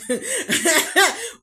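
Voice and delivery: shrieking burst of laughter; loud high laugh. Real, not acted. Laughing at being thought a liar.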